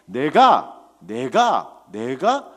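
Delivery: 내가 repeated with pauses, loud at first then easing; emphatic repetition. Shown as a demonstration. Preacher stressing who gives the blessing, 내가 punched. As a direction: hit each 내가 as its own phrase. Preacher hammers the key word.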